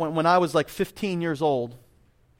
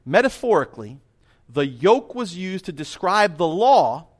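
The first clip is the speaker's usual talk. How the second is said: emphatic quote reading; 3 semitones higher than usual. Acted. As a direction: read it out weightily, lingering on key words. Reads out a quotation.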